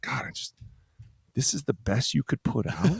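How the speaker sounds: unvoiced, soft disappointed coach; a quiet cutting put-down from a coach; hushed, disgusted muttering; muttered disappointment. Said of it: "he'd just be like".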